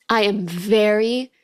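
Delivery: ordinary talk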